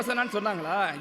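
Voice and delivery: ordinary delivery, nothing marked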